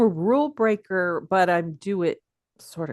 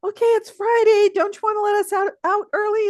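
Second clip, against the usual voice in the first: high, wheedling imitation of students; pleading students; 14 semitones higher, 5 dB louder than usual. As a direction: mimic students begging, pitched way up. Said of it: "I would have students say".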